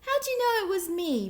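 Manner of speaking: high, whiny moan; caught-out turkey moaning. A narrator's reading. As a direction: complain like you've been caught. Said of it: "moaned Turkey".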